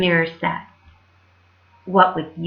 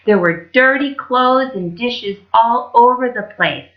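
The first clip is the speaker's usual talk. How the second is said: plain reading voice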